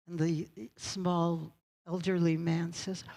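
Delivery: ordinary talk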